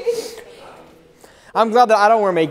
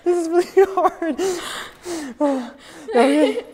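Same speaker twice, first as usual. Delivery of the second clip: crying through words; 10 semitones higher, slower than usual. Real, not acted. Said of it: "it's hard to pray when you're crying".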